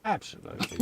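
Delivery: breathy whisper, no voice. Real, not acted. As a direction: whisper it, half to yourself. Whispered take of repeated word.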